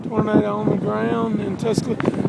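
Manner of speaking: normal talking voice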